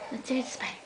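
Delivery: ordinary talk, nothing marked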